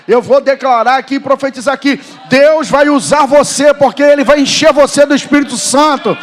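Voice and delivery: loud, building prophetic declaration; full-force preaching. Real, not acted. Preacher prophesies God will use you, fervent shout. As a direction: shout the promise over the congregation.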